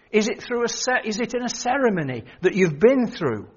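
loud, ringing sermon delivery; fervent preacher in full flow; preaching at full force. Acted. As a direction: preach it out to the congregation.

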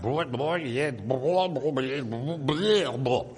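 imitating an unintelligible loudspeaker announcement; mock tannoy announcer. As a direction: garble it like a muffled station tannoy. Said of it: "it said basically something like".

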